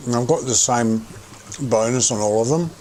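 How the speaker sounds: talking while eating; words round a mouthful; mouth full. Real, not acted. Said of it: "Excuse me for talking with my mouth full".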